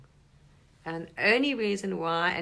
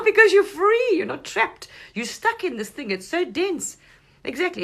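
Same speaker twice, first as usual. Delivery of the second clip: higher and louder, eager; excited; 13 semitones higher, 10 dB louder than usual. Real, not acted. Telling listeners the soul is free, excited and raised. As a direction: lift it with bright excitement. Excited about freedom from body.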